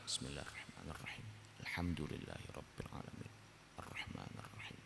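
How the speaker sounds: hushed, breathy prayer murmur; barely audible recitation. Shown as a demonstration. Teacher showing how to recite softly in prayer, barely audible. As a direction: recite so softly only your own ears hear it. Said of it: "melirihkan suara yang kita sendiri pribadi dengar".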